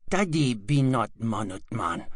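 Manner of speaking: ordinary delivery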